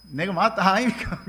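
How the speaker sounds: jokey Gyeongsang dialect, pitch jumping up at the end. Retold or imitated. Missionary mimics Robert Halley for the congregation, playful Gyeongsang accent. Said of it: "경상도 말하는 거 아닙니까".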